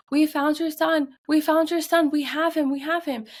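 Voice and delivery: put-on high, urgent child's call; excited shouting. Little girl shouting news to a searching father, excited shouting.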